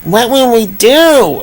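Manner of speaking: a small pig asking nervously; lightly voiced fretful pig; worried pig. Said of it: "another pig chimed in".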